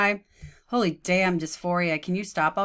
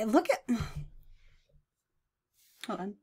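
ordinary delivery

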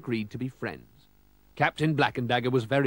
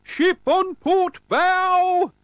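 very high, drawn-out lookout shout; lookout's cry; 9 dB louder, much slower than usual. A narrator's reading. Lookout sights a ship, high loud long cry. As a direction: holler it slowly across the deck. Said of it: "Suddenly he cried".

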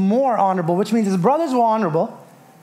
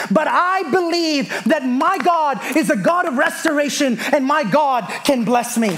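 driving, emphatic sermon delivery; impassioned preaching; 5 semitones higher than usual. Real, not acted. Preacher rallying a hurting congregation, impassioned preaching. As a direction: build with conviction, stretching the key words.

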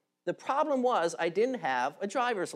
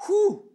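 whistling exhale; 12 semitones higher, 5 dB louder than usual. Real, not acted.

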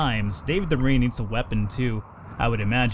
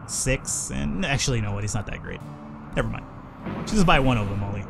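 ordinary talk

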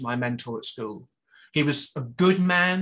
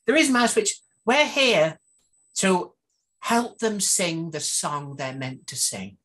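character voice; 4 semitones higher, slower than usual. Acted. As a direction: put on a lady's voice for the book. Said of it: "how she's gonna talk in my book".

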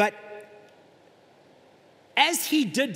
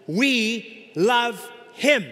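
"We love him" stretched very slowly and louder; slow emphasis; 4 semitones higher, 5 dB louder, much slower than usual. Acted. Preacher reading the verse to his church, slow and emphatic. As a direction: spell out each word with weight. Preacher quoting First John.

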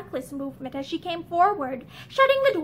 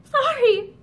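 breaking on a sob; 7 semitones higher, 5 dB louder than usual. A narrator's reading. Barbara to Linda, voice breaking into a sob. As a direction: pitch climbs higher and louder into a sob. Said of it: "Her voice broke on a sob".

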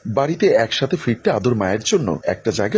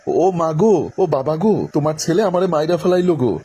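higher, louder hammed-up wail; 5 semitones higher than usual. Girl calling for her aunt, loud fake wailing. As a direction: wail for the whole house to hear. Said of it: "আরো জোরে জোরে বলছে".

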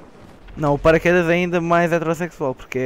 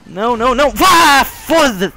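loud panicked shout; panicking gamer; 7 semitones higher, 6 dB louder, slower than usual. Real, not acted. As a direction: yell no as you lose. Losing to the tank, shouting.

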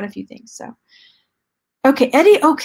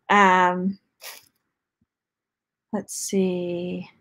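ordinary talk, nothing marked

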